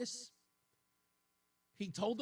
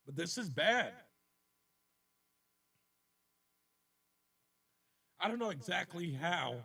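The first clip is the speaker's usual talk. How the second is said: real catch in the throat, voice tight and lower; choked up; 3 semitones lower than usual. Real, not acted. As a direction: push through being choked up on air. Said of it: "Excuse me for getting choked up here".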